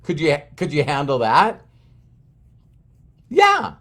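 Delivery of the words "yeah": very high little voice; squeaky meek yeah. Retold or imitated. Trainee answering the coach, meek high squeak. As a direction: answer small, pitched way up.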